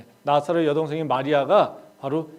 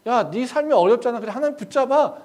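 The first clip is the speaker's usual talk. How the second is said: higher, emphatic exhortation; urging friend; 8 semitones higher, 9 dB louder than usual. Acted. Friend telling someone struggling to hold on to God, urging. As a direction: urge them like an insistent friend.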